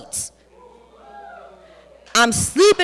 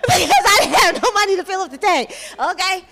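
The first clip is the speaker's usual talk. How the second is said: laughing; 6 semitones higher than usual. Real, not acted. Laughing at her own broke story.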